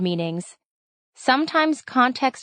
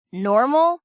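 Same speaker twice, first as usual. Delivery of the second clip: higher, rising incredulous question; raised-voice exclamation; 4 semitones higher than usual. A narrator's reading. Brother objecting to his sister calling him normal, exclaiming in disbelief. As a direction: toss the word back, pitched up in mild disbelief. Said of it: "Kyle raised his voice and exclaimed".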